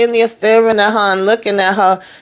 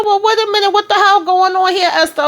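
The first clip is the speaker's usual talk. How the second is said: much higher, exasperated demand; a high-pitched what-is-going-on protest; high indignant outburst; 13 semitones higher than usual. Retold or imitated. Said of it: "I said".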